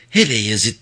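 ordinary delivery, nothing marked

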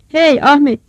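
loud, high hailing shout. Acted. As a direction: holler to your son far away. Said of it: "diye bağırdı sonra". (uzağa bağırarak seslenme)

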